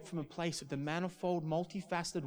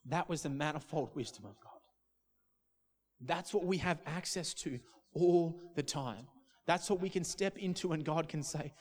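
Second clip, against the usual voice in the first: ordinary talk, nothing marked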